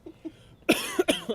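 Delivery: hard coughing fit; real choking cough. Real, not acted. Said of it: "I'm choking".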